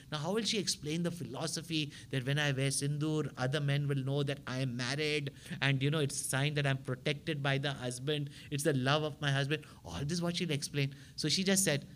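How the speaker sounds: ordinary talk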